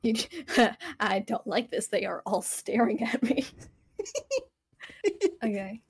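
ordinary talk

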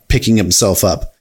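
plain reading voice

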